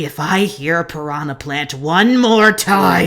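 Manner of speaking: slow threat swelling to loud anger; low, seething warning that boils over; exasperated threat. Acted. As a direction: build to a loud, drawn-out last word. Threat as temper runs out.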